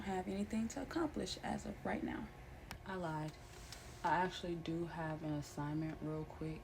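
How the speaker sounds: low, quiet, relaxed; soft-spoken. Real, not acted. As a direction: settle into a cozy murmur. Drops to soft chill talk.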